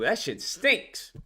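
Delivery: usual speaking voice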